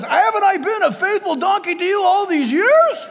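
high, loud donkey protest; hammed-up indignant plea, pitched high; aggrieved donkey. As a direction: wail it like a wronged comic donkey. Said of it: "he says".

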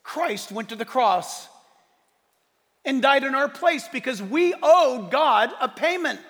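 usual speaking voice

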